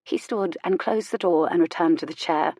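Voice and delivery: ordinary delivery, nothing marked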